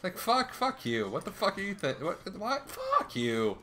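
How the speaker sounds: high-pitched frustrated swearing bursts; angry cursing at game. Real, not acted. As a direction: spit curses at the enemy, then snarl. Raging at game enemies.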